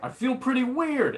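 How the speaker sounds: high, floaty admission; a high, dazed confession; drugged wonder. Retold or imitated. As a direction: announce it woozily, up high. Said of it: "drug-addled brain".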